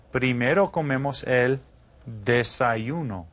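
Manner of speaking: desayuno drawn out slowly; slow model word. Shown as a demonstration. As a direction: hold every syllable of desayuno for the class.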